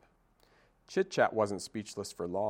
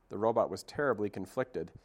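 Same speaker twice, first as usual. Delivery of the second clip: usual speaking voice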